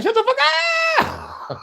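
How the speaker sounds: high mock yell from the background. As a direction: yell it like a hype man cutting in. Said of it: "I had [name] in the background".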